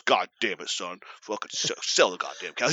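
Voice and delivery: low, grumbling dad curse; mock mad dad. Dad asked to accept phone help, mock mad. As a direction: imitate a fed-up father swearing at his son. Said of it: "He gets so mad".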